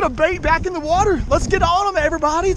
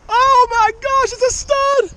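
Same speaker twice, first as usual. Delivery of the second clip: much higher, thrilled exclamation; excited disbelief; 10 semitones higher than usual. Real, not acted. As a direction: gasp it out, amazed at the size. Landing a huge fish.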